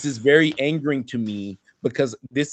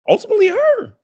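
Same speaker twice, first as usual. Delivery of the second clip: much higher and louder, a mock outburst; exaggerated high yell; 8 dB louder than usual. Real, not acted. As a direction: blurt it out high and loud for comic effect. Blurts provocative answer.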